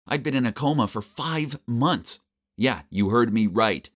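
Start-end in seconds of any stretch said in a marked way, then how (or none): none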